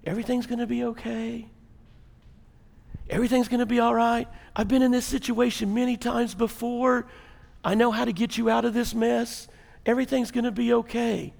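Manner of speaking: very soft, soothing reassurance; calming voice. Acted. Air traffic controller promising rescue to passenger, calming voice. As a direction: hush it down like settling someone in panic. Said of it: "He's a calming voice".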